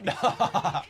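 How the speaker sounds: high, slow laughing exclamation; cracking up in disbelief. Real, not acted. Laughing at reckless play.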